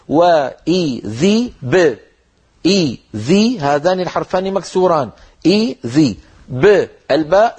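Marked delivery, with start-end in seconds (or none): 0.1-3.6 very slow, the ب in وإذ ب bounced without a vowel; echoing qalqala ba. Shown as a demonstration. Teacher correcting students on the qalqala of ba, slow and exact. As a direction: give the ب a short echo, no kasra after it. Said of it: "بل نقول".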